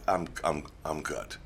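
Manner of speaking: low, soft, deadpan refusal; understated self-quote. Retold or imitated. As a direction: drop low and quiet, politely declining. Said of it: "and I'm like".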